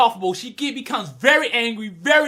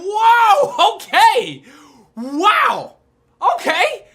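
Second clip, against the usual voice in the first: high, loud drawn-out exclamations; amazed reaction; 9 semitones higher, 7 dB louder, much slower than usual. Retold or imitated. Viewer hearing Mako must be punished, amazed. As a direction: react in bursts, taken aback.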